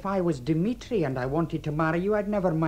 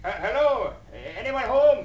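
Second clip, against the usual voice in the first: high, raised visitor's call; tentative hello, then a louder call; 6 semitones higher, 4 dB louder than usual. Acted. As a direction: call into a house to see who's in. Visitor calling into the house.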